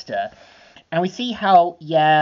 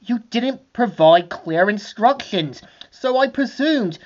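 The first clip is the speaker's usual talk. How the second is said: Archibald excuse; 7 semitones higher than usual. Acted. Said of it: "we see him saying".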